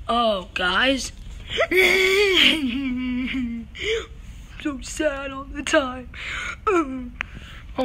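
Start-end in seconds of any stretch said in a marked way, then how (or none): 1.2-4.6 high, wailing mock sob; hammed-up wailing cry in a far-off voice; crying noise. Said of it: "What's that crying noise?".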